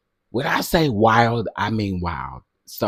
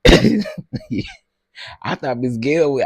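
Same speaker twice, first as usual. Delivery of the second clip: laughing; 2 semitones higher, 4 dB louder, much slower than usual. Real, not acted. Laughing at Miss Gail.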